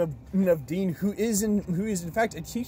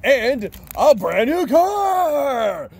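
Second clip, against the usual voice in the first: booming, drawn-out announcer shout; game show host; 7 semitones higher, 7 dB louder, much slower than usual. Pretend. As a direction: shout it like a TV prize reveal. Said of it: "you told me to talk like a game show host".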